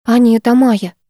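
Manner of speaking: usual speaking voice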